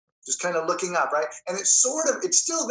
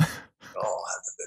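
hushed, much lower ordering; cool voice whisper; 8 semitones lower, 6 dB quieter than usual. Retold or imitated. Actor ordering sushi in his cool voice, hushed. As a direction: murmur the order too quietly to hear. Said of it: "ordering sushi".

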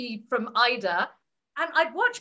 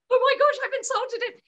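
shocked realisation; 9 semitones higher, 4 dB louder than usual. Retold or imitated. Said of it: "and I was like".

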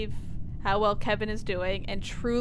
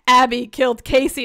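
louder, insistent quoted claim; loud accusation; 7 dB louder than usual. Retold or imitated. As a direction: call it out like an accusation. Quoting Ben's wrong accusation.

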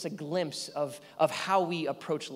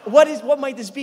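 louder, higher curious question; eager wonder; 8 semitones higher, 12 dB louder than usual. Retold or imitated. Opening a package on the porch, eager and loud. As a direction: wonder aloud with thrill. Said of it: "but you're like".

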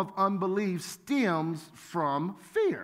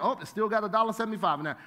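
ordinary delivery

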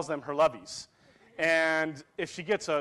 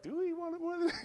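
very high, softer questioning echo of a chant; mock team question; 13 semitones higher, 5 dB quieter, faster than usual. Team answering the game-day chant, high mock question. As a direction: throw the chant back as a high-pitched question. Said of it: "And we're like".

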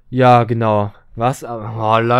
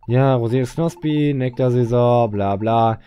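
usual speaking voice

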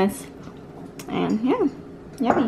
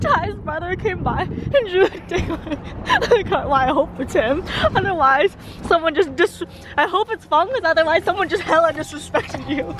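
laughing disbelief; 6 semitones higher than usual. Real, not acted. Laughing telling story.

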